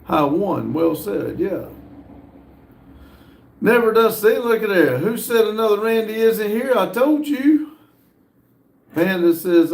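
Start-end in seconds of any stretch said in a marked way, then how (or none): 3.6-7.7 excited gotcha. Real, not acted. Streamer catching Randy in the background, excited and triumphant. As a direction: crow that you were right all along.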